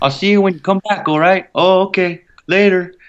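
breezy reservation-accent small talk with a friend; Res accent. Shown as a demonstration. Actor demonstrating a Native reservation accent. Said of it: "it's a Res accent".

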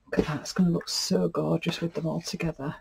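a soft, wondering whisper; delighted whisper. Real, not acted. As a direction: hush it out in admiration. Admiring pieces laid together.

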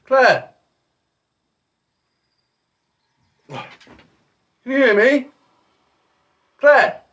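shouting a name across the house; calling out. Real, not acted. Calling out to Claire upstairs.

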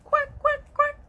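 high, loud duck quacks; happy duck quacking. Acted. Ducky showing children how happy sounds, high quacking. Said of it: "When I'm feeling happy, I say".

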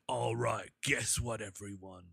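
low, hushed tough-guy voice; soft, low villain addressing the room; Idris Elba. Acted. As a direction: drop it down, quiet and deliberate. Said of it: "my voice for Idris Elba and Jason Statham".

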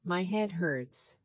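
normal talking voice

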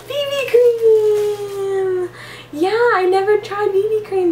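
high, slow, teary shout; squealing shout. Real, not acted. Squealing at her twin over the BB cream. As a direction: shout it out with delight. Finding something she never tried.

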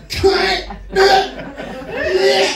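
high, loud laughing shout; high mock yell. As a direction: blurt it out, laughing. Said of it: "they go".

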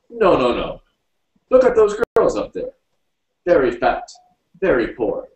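high, loud voice of an emphatic foreigner; animated Egyptian character, loudly disagreeing; Egyptian arguing back. A narrator's reading. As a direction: contradict firmly in short, high-pitched bursts.

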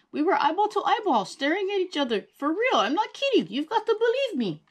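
put-on high pleading kid voice. A narrator's reading. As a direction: beg to be believed. Boy pleads to be believed.

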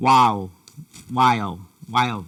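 while said slowly with a clear L at the end; เน้นเสียง L ท้ายคำ while; careful while. Shown as a demonstration. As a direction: say while slow, gliding into the final L. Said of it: "คำนี้นะครับ".

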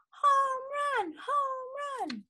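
high, drawn-out mock shout. A narrator's reading. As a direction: cheer it high without full volume. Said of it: "stomped on home plate and shouted".

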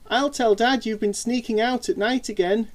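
mumbling through pizza; mouth full. Acted. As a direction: talk through cold pizza. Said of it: "he mumbled through a mouthful".

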